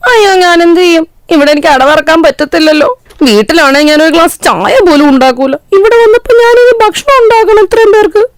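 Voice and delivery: high, fretful bride's voice, climbing; lazy bride panicking about cooking for everyone; worried new bride talking to herself, voice climbing high; panicky young bride. Acted. Rani panicking aside.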